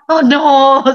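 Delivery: loud, drawn-out mock dismay; hammed-up groan of dread; dismayed colleagues. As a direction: imitate everyone's hopes collapsing. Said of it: "Semua gitu seperti itu".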